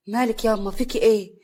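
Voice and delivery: ordinary delivery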